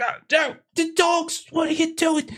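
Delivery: very high-pitched, fretting householder; dismayed Cole Pickthorn. Acted. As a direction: fret in a high voice at the dogs. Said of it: "like, Pickthorn's like".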